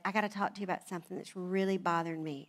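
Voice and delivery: low, soft, quick confession; very serious. Retold or imitated. Husband raising a grievance with new wife, gravely serious. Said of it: "came to me and was very serious".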